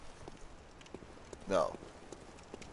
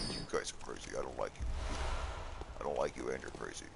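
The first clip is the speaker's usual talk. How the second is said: breathy whisper, much softer; whispered aside; 10 semitones lower, 10 dB quieter, faster than usual. Real, not acted. Grumbling to the crows, hushed whisper.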